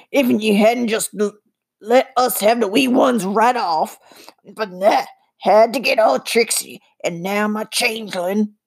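gruff Scottish goblin grumble; angry little man with sharp teeth. A narrator's reading. Voices the goblin.

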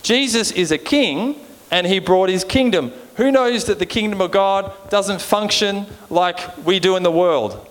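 ordinary delivery

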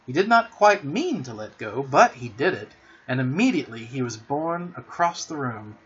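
plain reading voice

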